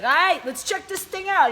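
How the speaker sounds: high, loud, slow wiseguy doctor; smarmy doctor. Retold or imitated. Gynecologist about to examine a teen, slow loud sleazy voice. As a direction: savor it like a sleazy doc.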